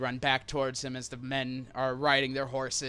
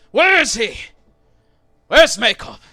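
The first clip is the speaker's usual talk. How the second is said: loud, higher angry demand; men shouting; 11 semitones higher, 19 dB louder than usual. Acted. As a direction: bark it from horseback. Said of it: "group of men riding up".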